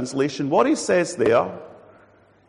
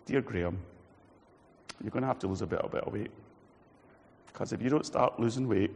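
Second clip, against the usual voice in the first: soft, lower, caring warning; gentle doctor; 6 semitones lower, 7 dB quieter than usual. Retold or imitated. Doctor gently warning Graham about his weight, soft and caring. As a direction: break the bad news kindly. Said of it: "a God who's more like a doctor".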